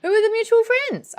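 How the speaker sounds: very high, quick, loud question; high-pitched curious question. Real, not acted. Host quizzing new member about mutual friends, high-pitched curiosity. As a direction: shoot the question up into a squeak.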